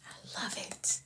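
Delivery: unvoiced whisper of "I love it.". Real, not acted. Whispered then repeated aloud.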